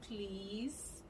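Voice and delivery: soft, drawn-out please with rising end; coaxing little mock plea; imitated polite plea. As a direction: stretch it softly upward, imitating a plea. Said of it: "I was trying to be like".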